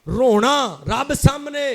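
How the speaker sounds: sudden loud exhortation with swooping pitch; emphatic preaching cry. Real, not acted. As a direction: call it out forcefully to the congregation. Cries out a point in sermon.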